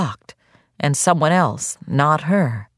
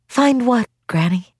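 put-on young girl voice; 8 semitones higher than usual. Lina voiced by the narrator.

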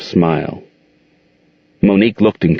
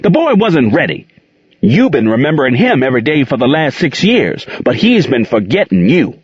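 angry scolding; 9 semitones higher than usual. A narrator's reading. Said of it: "she roared".

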